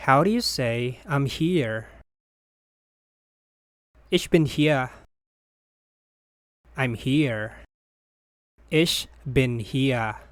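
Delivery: ordinary delivery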